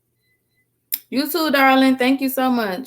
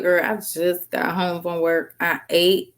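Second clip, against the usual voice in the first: usual speaking voice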